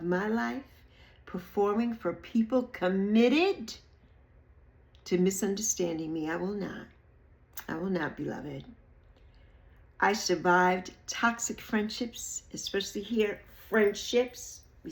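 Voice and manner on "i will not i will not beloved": soft, low firm vow; quiet resolve. Real, not acted. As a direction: settle it softly and firmly.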